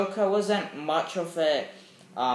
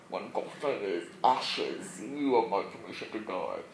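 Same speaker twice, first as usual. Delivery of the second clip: Bane; 5 semitones lower, 3 dB quieter than usual. Acted. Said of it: "the scene of Bane".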